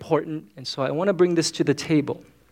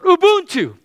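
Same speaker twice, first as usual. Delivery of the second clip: high, loud huddle-breaking cry; team shout; 12 semitones higher, 6 dB louder than usual. Retold or imitated. Boston Celtics breaking from a timeout huddle, shouting out. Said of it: "the Boston Celtics would shout out".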